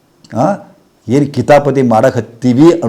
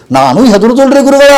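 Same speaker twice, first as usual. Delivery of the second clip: high, louder woman's voice, insistent; imitated fearful woman; 12 semitones higher, 7 dB louder than usual. Guru voicing the woman who fears cancer: high, insistent plea. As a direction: play the panicked disciple pleading. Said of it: "ಅಂತ ಆಕೆ ನನಗೆ".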